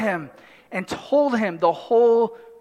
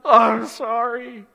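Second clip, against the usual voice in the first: put-on sorry kid. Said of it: "their response is".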